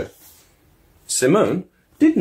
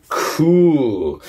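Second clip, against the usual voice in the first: put-on delighted kid; 6 semitones higher, 5 dB louder than usual. Simone delighted.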